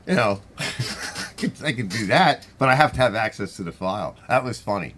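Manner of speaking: usual speaking voice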